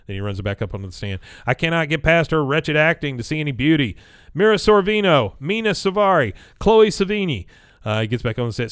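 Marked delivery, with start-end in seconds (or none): 1.5-7.5 higher, louder retelling of dismissals; imitated dismissive witness. Witness rejecting the lawyer's magazine beauties, mock dismissive. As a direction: toss off each name, pitched up.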